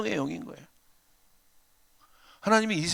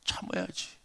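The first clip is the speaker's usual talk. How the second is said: softer, calming plea; gentle wife; 9 dB quieter, slower than usual. Retold or imitated. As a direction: soothe your husband quietly. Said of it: "저희 아내가 저한테 막 뭐라고". (남편을 달래는 아내의 조용한 목소리)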